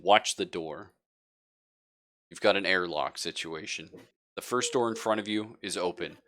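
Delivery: ordinary talk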